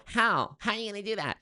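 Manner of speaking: high-pitched Valley Girl impression; Jessica Higdon. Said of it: "Valley Girl waspy".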